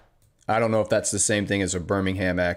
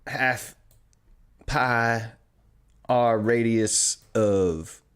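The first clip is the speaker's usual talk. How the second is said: slow, lazily stretched Southern drawl, a bit higher; mock Southern drawl; 3 semitones higher, much slower than usual. Streamer imagining a Southern calculus professor lecturing, mock Southern drawl. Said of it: "listening to Calculus in a Southern accent".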